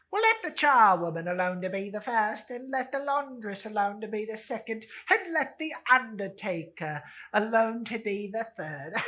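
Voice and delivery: high, loud, laughing cockney woman; rowdy charwoman. Acted. Charwoman greeting old Joe, loud and laughing. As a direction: crow it out to the whole shop.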